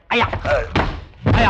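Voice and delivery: loud, sharp yelp of pain; startled pained shout; pained cry. Acted. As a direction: cry out as you are thrown. General struck by judo throw.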